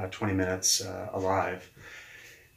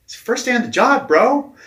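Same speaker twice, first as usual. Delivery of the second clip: much higher and louder, incredulous; the boss can't believe it's day one; mock-shocked boss yelling; shocked disbelief; 14 semitones higher, 12 dB louder than usual. Boss's shocked reaction retold.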